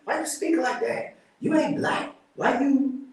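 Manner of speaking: high, mocking voice of teasing schoolkids. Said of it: "I used to get made fun of".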